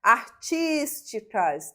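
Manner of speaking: "Artísticas" with TÍS drawn out; stressed syllable demonstration. Shown as a demonstration. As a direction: slowly stretch TÍS in "Artísticas". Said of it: "também".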